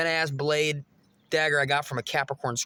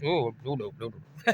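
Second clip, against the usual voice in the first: mock hushed no; 6 semitones lower, 5 dB quieter than usual. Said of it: "she on a different timeline is".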